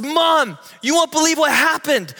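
high, loud call to mom, bursting with news; excited little sister. Retold or imitated. Sister runs home shouting to mom, high and excited. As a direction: shout the news like a breathless kid.

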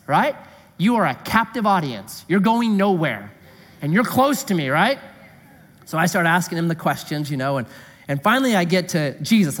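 ordinary delivery

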